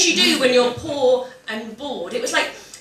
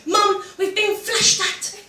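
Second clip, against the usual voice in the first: higher, slow, drawn-out eager announcement; breathless kid excitement; 9 semitones higher, much slower than usual. Retold or imitated. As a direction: blurt it out to mum like the best news ever. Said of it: "You couldn't wait to tell your mum".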